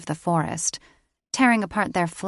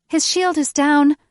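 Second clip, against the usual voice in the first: high, loud frightened wail; 9 semitones higher, 7 dB louder than usual. A narrator's reading. Lily wails the alarm to the others, high and loud. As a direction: cry it out in panic. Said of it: "Lily wailed".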